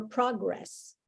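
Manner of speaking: PROgress as a noun, first syllable stressed. Shown as a demonstration. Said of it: "there is a noun".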